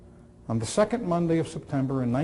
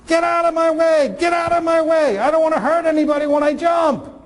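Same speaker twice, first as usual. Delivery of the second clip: high, loud frantic shout from a ledge; retold frantic yell; 13 semitones higher, 5 dB louder than usual. Boy on a window ledge yelling to people below, loud and frantic. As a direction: yell it down to the crowd below, panicked. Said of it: "he's yelling to the people below him".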